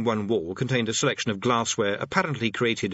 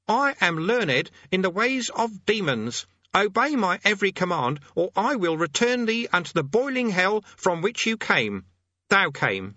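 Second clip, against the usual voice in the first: high-pitched, declamatory incantation; self-important conjurer; 9 semitones higher than usual. Acted. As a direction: command a demon in a high voice. Conjurer threatens demon, corrects his grammar.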